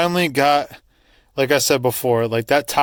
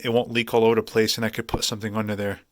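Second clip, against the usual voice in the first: ordinary talk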